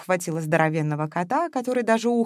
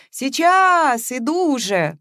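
high, loud call toward the door; 7 semitones higher, 5 dB louder, much slower than usual. A narrator's reading. Masha calls out to whoever rings the doorbell. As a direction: call out to the ringing visitor.

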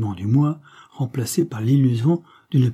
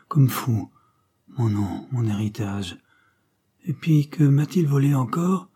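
a dazed madman dragging memories out piece by piece; halting, broken voice; 2 semitones higher than usual. A narrator's reading. Confused patient blurts out his stolen identity to the doctor, halting and jerky. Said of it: "d'une voix saccadée et fréquemment interrompue par de longues pauses".

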